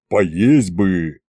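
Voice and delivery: low, voiced stage whisper of a dragon; dragon's stage whisper. A narrator's reading. Hungry dragon nags Fedya from behind, low stage whisper. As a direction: plead for food in a hint of a low dragon voice. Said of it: "оглушительно шепнул сзади Горыныч".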